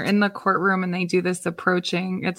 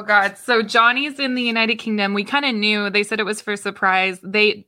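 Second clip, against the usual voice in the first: normal talking voice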